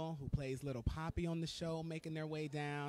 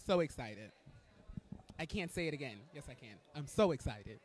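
normal talking voice

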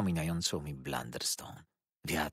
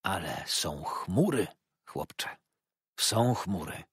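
slow, hushed, troubled; old man confiding his worry, gently moved; slower than usual. A narrator's reading. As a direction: let chmury fall heavy. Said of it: "Zdawał się wzruszony".

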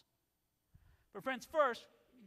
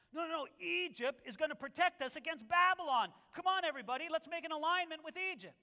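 eager politicians; 6 semitones higher, 4 dB louder than usual. Retold or imitated. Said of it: "the politicians didn't want to talk like that".

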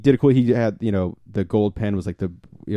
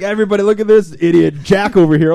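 mock taunt; 9 semitones higher, 6 dB louder than usual. Mock taunt in retold exchange.

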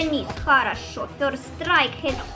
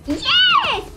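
high triumphant cheer, louder; triumphant yell; 4 dB louder than usual. Real, not acted. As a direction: cheer the hit. Cheering a hit.

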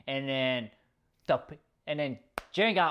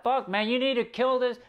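urging to finish him; 8 semitones higher than usual. Retold or imitated. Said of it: "Belmont's like".

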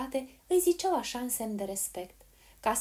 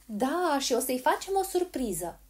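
high, loud, eager announcement; raised girl's voice; calling out; 5 semitones higher, 5 dB louder than usual. A narrator's reading. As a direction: shout the news to granny. Polly shouting to deaf granny.

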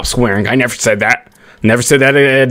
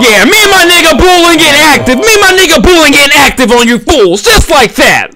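high, loud put-on hype voice; hype persona; 14 semitones higher, 6 dB louder than usual. Gamer boasting to opponents, high and loud put-on voice. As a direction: show off to the other team.

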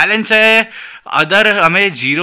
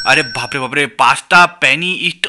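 usual speaking voice

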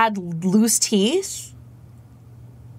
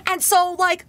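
normal talking voice

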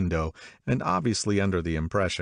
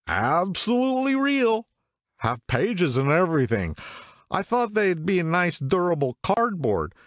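much higher, louder, amazed tipsy voice; tipsy man raving to strangers about real books; excited drunk man; 12 semitones higher, 3 dB louder than usual. A narrator's reading. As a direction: gush like a drunk who found a marvel.

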